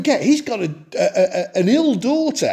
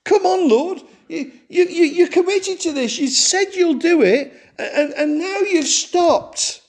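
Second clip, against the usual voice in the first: high, laughing mock complaint to God; the believer complaining to the Lord about delays, half-laughing and exasperated; exasperated plea; 8 semitones higher than usual. Preacher voices frustrated prayer.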